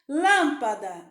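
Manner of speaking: "Lâmpada" slow, with a loud "Lâm"; stressed first syllable. Shown as a demonstration. Teacher picking out the syllable to colour, stress on "Lâm". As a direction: stretch and punch "Lâm" in "Lâmpada". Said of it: "você vai pintar o lã".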